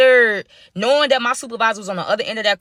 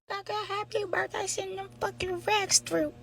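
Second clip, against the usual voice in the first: much higher and much softer, teasing sing-song; mock sing-song; 10 semitones higher, 14 dB quieter than usual. Real, not acted. Narrator teasing the supervisor sitting on cash, soft high sing-song. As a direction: lilt it like a mocking birthday tune.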